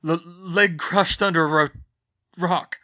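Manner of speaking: halting, broken words; stammering. Acted. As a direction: get it out through the shock. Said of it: "Harry stammered".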